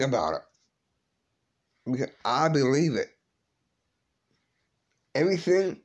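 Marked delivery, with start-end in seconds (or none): 5.1-5.8 close to tears. Real, not acted. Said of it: "No, I'm not gonna cry".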